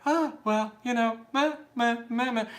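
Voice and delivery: high, feeble bleating; wishy-washy voice trailing into meh; mocking meek churchgoers. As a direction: mumble like a limp, timid believer. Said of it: "people that go to the church are like".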